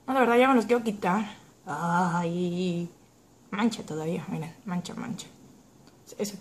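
stiff coated lips; talking through lip film; lips coated with tint. Real, not acted. Seen 2: Glossy red tint film covers her lips throughout the labelled words.

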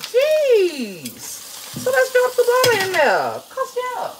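high playful aside; high put-on voice. Keeps high voice.

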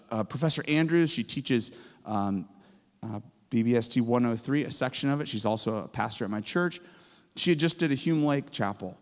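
usual speaking voice

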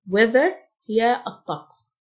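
ordinary delivery

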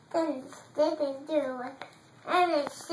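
ordinary delivery

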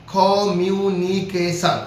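slow communication, broken into its five syllables; slow teacher demo of communication in five parts; split into syllables. Shown as a demonstration. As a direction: spell out communication slowly, part by part. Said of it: "পাঁচভাবে ভাঙা যাচ্ছে".

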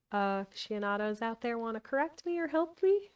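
usual speaking voice

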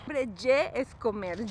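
normal talking voice

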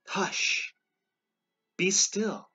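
high, dramatic command to be still; hushing command. A narrator's reading. Jesus calming wind and waves, hushing command. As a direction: order the storm to settle.

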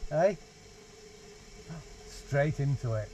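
ordinary delivery

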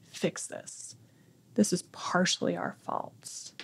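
hushed and guilty, breath only; whispered confession. Retold or imitated. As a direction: whisper it, barely voiced, to your son. Confessing guilt to her son.

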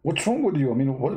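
ordinary delivery

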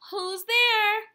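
high, loud call toward the door; a playful door call. Acted. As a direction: call out who's there. Calling out at the door.

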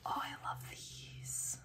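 soft, slow, breathy whisper; hushed delight. Real, not acted. Collector in love with freebies, hushed delight. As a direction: whisper it slowly, swooning.

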